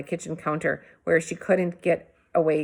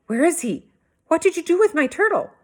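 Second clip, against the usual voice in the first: higher, louder, fast yell; 11 semitones higher, 5 dB louder than usual. A narrator's reading. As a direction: blow up at the little brother. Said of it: "I yelled".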